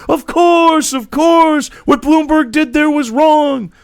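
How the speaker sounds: high, loud, emphatic pundit voice; Cenk impression. Mocking Cenk conceding the Bloomberg money, high and loud. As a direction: ham up the outraged pundit, slow at the end. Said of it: "I can't really do my Cenk".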